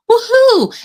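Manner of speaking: very high, loud whoop of joy; very high, loud excited woohoo; cheering whoop. Real, not acted. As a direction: let out a delighted cheer. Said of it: "celebrating own realization".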